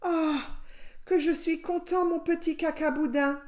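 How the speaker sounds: high, happy cry of reunion; joyful relief. A narrator's reading. Papa rabbit finds his little one, joyful relief. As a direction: lift the pitch high with delight.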